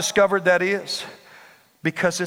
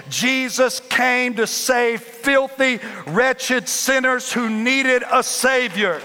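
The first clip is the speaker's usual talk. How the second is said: preaching climax; 3 semitones higher, slower than usual. Acted.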